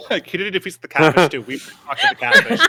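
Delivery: gleeful laughing rush; cracking up while explaining. Real, not acted. Excited laughing recap.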